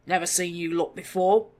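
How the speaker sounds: loud rough goblin growl; suspicious growl. A narrator's reading. Goblin butcher growling at the strangers. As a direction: snarl it with suspicion. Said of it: "he growled".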